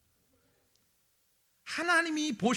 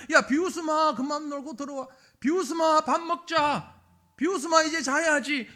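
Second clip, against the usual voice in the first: louder call of "비웃음아" across the yard; calling a child; 6 semitones higher, 7 dB louder than usual. Acted. Parent calling the son in from play, calling out louder. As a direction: call the kid in for dinner.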